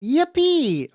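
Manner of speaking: high, loud little cheer; excited cheer. Real, not acted. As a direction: squeal it out with joy. Cheering chat's firsts.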